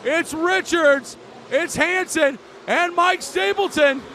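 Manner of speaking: ramp up as the field roars off; excited race call. Real, not acted. Restart calling the field.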